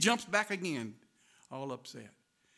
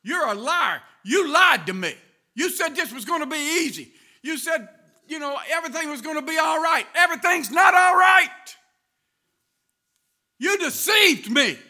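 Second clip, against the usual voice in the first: furious accusation; 10 semitones higher, 17 dB louder than usual. Retold or imitated. Angry preacher accusing God of lying, shouting. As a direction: hurl each accusation at God. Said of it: "He said".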